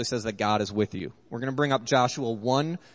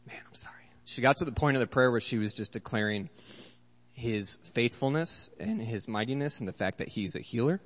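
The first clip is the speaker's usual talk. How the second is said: tearful whisper; 4 dB quieter than usual. Real, not acted. Choked up retelling wife's prayer.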